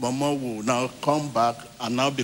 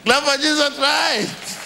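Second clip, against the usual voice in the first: high, drawn-out praise shout; big rallying cry to the congregation; rallying call; 12 semitones higher, 4 dB louder, slower than usual. Real, not acted. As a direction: call the crowd to applaud, slow and high. Preacher calls congregation to clap.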